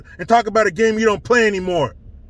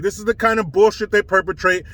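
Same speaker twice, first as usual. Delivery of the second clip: ordinary talk, nothing marked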